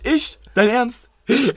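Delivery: high, slow and incredulous; indignant disbelief. Real, not acted. Teased friend challenges the comparison, indignant disbelief. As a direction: stretch it out loud, affronted.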